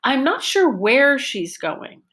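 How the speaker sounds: high rise-fall on where; focus on where. Shown as a demonstration. Teacher modelling question word where as focus. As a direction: lift and drop the pitch on where. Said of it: "the word where is ... the focus".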